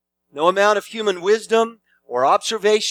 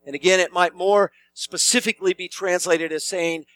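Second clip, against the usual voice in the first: usual speaking voice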